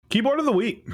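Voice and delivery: announcer intro. Acted.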